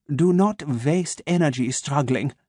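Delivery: put-on slow, crisp German-accented menace; measured German doctor, each word placed; a calm German voice waking his captive. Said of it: "his German accent crisp and sharp".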